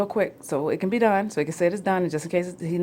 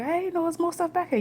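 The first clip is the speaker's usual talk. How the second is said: discovering voice; 12 semitones higher than usual. Retold or imitated. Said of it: "Be like".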